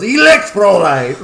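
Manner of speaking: loud, drawn-out yell. Retold or imitated. Retelling a friend's yell in a movie, loud and drawn out. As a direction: belt out the word like a battle cry. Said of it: "he just yells".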